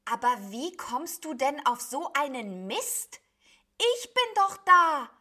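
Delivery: loud, high indignant griping; exasperated bleating. A narrator's reading. Sheep insists to cloud beast that it exists, loudly grumbling. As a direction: complain sharply, rising to a shout. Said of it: "meckerte das Schaf".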